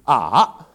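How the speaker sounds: ah dipping down then up; third tone. Shown as a demonstration. Teacher showing Mandarin third tone, dipping ah. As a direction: let ah fall into a valley and climb back. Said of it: "is kind of a valley".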